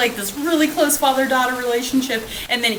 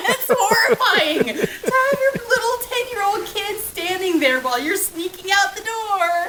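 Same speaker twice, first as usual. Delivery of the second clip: high, laughing disbelief; 9 semitones higher than usual. Real, not acted. Host laughing to co-host about a sad scene, laughing. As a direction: laugh through the outrage.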